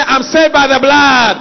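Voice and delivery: loud, slow shout of faith; shouted declaration. Real, not acted. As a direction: proclaim it with full voice. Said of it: "Shout and say".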